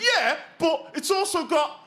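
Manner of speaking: high-pitched mock gangster attitude; slow, defiant comic comeback; bad attitude. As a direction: imitate a stroppy Brummie gangster, high and drawn out. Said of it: "he had this really bad attitude".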